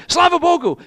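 loud, high, joyful Slava Bogu; excited shout. Retold or imitated. Preacher echoing the man's praise, joyful and loud. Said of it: "I was excited too".

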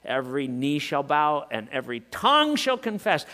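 ordinary talk

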